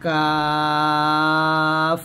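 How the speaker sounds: কাফ held long and thin, light; light Arabic kaf. Shown as a demonstration. Teacher demonstrating a thin কাফ to students. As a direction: draw কাফ out lightly in recitation tone. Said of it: "কাফটা পাতলা করে উচ্চারণ করবেন".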